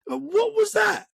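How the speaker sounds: high, startled "what was that"; stunned, disbelieving question; shocked. Retold or imitated. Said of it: "we both looked at each other like".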